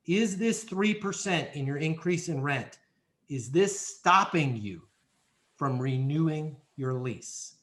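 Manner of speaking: slow, measured, each phrase set apart; slow careful question. Shown as a demonstration. Leasing agent calmly checking a tenant's objection, slow and measured. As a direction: take your time, ask it gently and clearly. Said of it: "maybe even throw it out like this".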